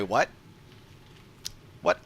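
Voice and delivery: ordinary talk, nothing marked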